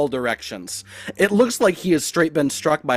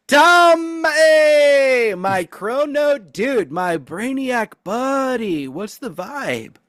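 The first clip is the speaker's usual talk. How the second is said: much higher, louder, slow drawn-out greeting; goofy surfer dude; 8 semitones higher, 4 dB louder, much slower than usual. Acted. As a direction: hail them like a stoned beach buddy. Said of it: "Tamias kinda turns the corner".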